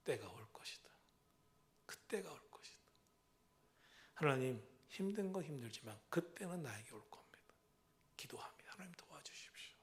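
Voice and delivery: hushed, intimate prayer; whispered prayer. Acted. Believer whispering a prayer to God. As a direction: whisper it as if praying alone.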